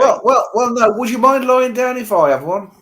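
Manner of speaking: Australian accent. Shown as a demonstration. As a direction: play a cheeky Aussie chancer. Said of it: "that's the Australian one".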